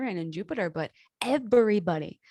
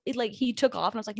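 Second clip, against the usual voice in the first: usual speaking voice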